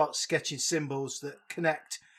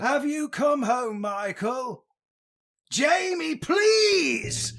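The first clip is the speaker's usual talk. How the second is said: much higher, slow, rising to a hammy shout; a full mock-tragic plea to Jamie; Loomis impression; 10 semitones higher, 4 dB louder, much slower than usual. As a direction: wail it like a desperate old doctor. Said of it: "I'm gonna do it again".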